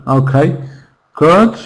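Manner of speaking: ordinary talk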